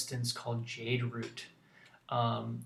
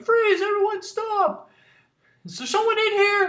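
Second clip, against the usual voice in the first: much higher, loud commanding guard; guard shouting; 12 dB louder than usual. Acted. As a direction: officers bursting into the room. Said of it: "they're like".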